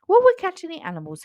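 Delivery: high, fast eager question; bright little cub asking quickly; curious cub. A narrator's reading. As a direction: blurt it out like an excited cub. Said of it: "he asked".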